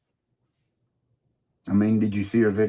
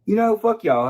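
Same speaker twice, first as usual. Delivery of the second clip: loud, higher fuck-off to the whole crowd; retold defiance; 10 semitones higher, 4 dB louder than usual. Telling off the people conspiring against him, loudly. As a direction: throw it at them, louder. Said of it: "I say".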